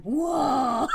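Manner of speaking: loud growled whoa; shouted whoa. Retold or imitated. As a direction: belt out the whoa. Imitating the song's growl.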